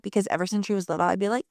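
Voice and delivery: ordinary talk, nothing marked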